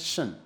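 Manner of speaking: "shun" as one short syllable, a sh sound first; pronounced "shun". Shown as a demonstration. Teacher showing students how the noun ending sounds, pronounced "shun". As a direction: say "shun" with a sh and a weak vowel. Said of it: "we are pronouncing as".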